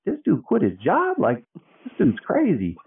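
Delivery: imitating viewers' shocked disbelief; mock disbelief. Viewers reacting to him quitting his job, high incredulous voice. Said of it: "everybody's like".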